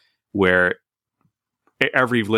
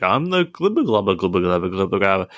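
imitated movie character. Retelling a sampled children's movie line, light imitation. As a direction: imitate a silly chant, dropping back after the first phrase. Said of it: "where a guy's like".

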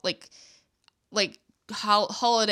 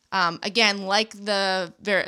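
normal talking voice

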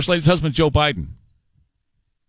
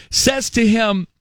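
ordinary delivery

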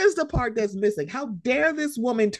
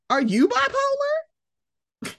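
high, slow, mocking sing-song of the question; mocking repeat; much slower than usual. Podcast host mocking a reality star's question, high and drawn out. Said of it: "Why would he say, 'Are you bipolar?'".

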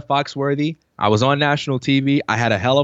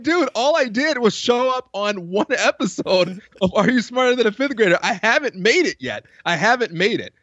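higher, mock-exasperated protest, slowing down; incredulous protest; 8 semitones higher than usual. Telling the former hater, a high disbelieving protest. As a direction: protest in disbelief that one show means success. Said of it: "And I'm like".

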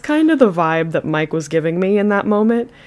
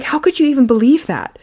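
higher, affronted retelling; mock indignation; 7 semitones higher, 4 dB louder than usual. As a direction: imitate her almost angry reproach. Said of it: "she's almost getting angry with him".

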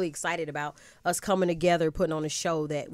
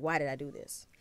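quiet regret; 4 semitones lower, 10 dB quieter than usual. Retold or imitated. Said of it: "I was like".

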